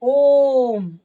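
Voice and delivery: ओम held loud and very long, the ओ stretched three times over; drawn-out Om. Shown as a demonstration. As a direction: stretch the ओ of ओम to triple length, loudly. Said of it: "ओ को तीन बार बोलना पड़ेगा".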